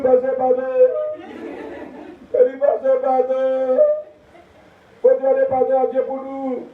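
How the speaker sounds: pardon crié haut, lent à la fin; supplication. Acted. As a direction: implore le pardon. Woman begging forgiveness. (femme qui implore le pasteur, voix haute)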